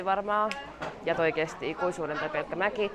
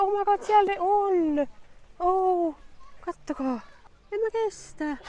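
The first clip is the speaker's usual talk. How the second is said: much higher delighted squeals; giraffe excitement; 13 semitones higher, slower than usual. Real, not acted. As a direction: squeal with joy. Seeing giraffes up close. (korkea innostunut kiljunta)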